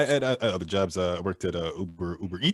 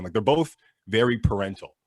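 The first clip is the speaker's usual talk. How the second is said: usual speaking voice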